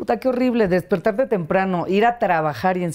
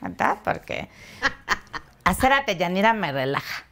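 Deyanira character voice; 2 semitones higher than usual. Shown as a demonstration. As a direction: slip into your comedy alter ego. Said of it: "ni hablaba así ni nada".

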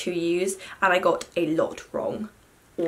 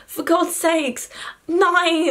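exasperated; 8 semitones higher, much slower than usual. Real, not acted. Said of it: "It's so frustrating".

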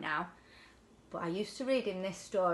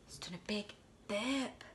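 put-on lively storybook voice for a child; bedtime story reading; 3 dB louder than usual. A narrator's reading. Parent reading a picture book to children, lively. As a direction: read it like a bedtime picture book to a little one.

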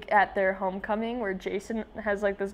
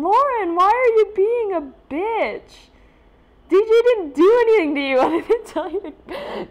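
high, loud, laughing scold; mock outraged scold; 11 semitones higher, 10 dB louder than usual. Real, not acted. Viewer scolding Lauren for being rude, loud and laughing. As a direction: scold the character while cracking up.

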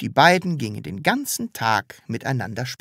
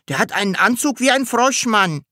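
high-pitched pupil calling out; 11 semitones higher, 4 dB louder than usual. A narrator's reading. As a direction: pipe up excitedly from the class. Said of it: "rief ein dritter". (hoch dazwischengerufen)

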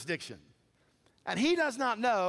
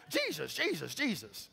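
mock pleading; 3 semitones lower than usual. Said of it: "You ever seen people do that?".

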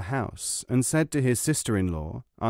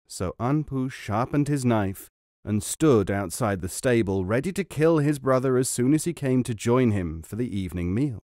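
usual speaking voice

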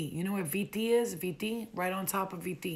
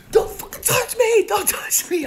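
shrill high scream, very loud; 13 dB louder, slower than usual. Retold or imitated. Woman screaming at a soldier grabbing her. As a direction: scream it as you jump on him.

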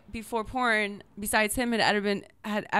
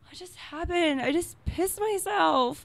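stunned disbelief; 4 semitones higher than usual. Retold or imitated. Retold shock after wetting.

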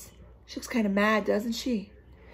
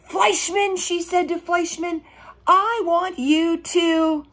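put-on bossy girl voice; 5 semitones higher, 4 dB louder than usual. Bossy Lulu scolding Fleischman.

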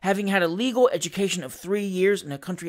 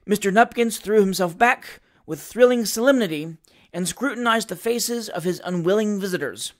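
ordinary delivery, nothing marked

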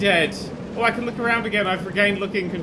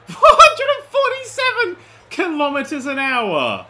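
loud, high laughing shout; laughing disbelief; 9 semitones higher, 9 dB louder than usual. Real, not acted. Player reading the top speed, laughing in shock.

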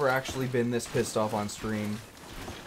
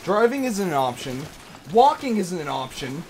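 annoyed rant; 5 semitones higher, 5 dB louder than usual. Real, not acted. Ranting about route choices.

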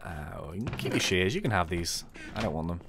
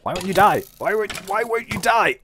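building from raised voice to full high yell; frantic, louder with each cry; exasperated shouting; 10 semitones higher, 11 dB louder than usual. Real, not acted. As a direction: scream at an enemy that won't go down. Losing a game fight.

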